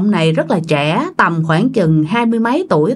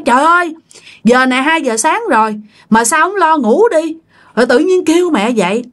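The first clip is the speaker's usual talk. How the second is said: annoyed scolding; 6 semitones higher, faster than usual. Retold or imitated. Mother scolds at 2am.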